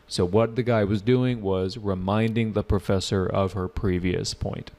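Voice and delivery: normal talking voice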